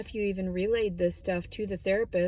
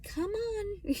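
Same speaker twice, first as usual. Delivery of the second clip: high, coaxing call to a little dog; sing-song come on to the dog; coaxing an unwilling dog on a leash, much higher; imitating the lady; 13 semitones higher than usual. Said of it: "she was just like".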